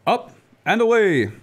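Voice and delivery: loud rising heroic cry, drawn out; heroic call. Acted. Superman calling out as he takes flight, heroic cry. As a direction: launch it like a takeoff shout.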